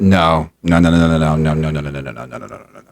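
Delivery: rapid string of no's, sinking low and trailing to nothing; low muttered refusal, dying away; dismissive fading no's. Real, not acted. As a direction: rattle off no's, fading to a whisper.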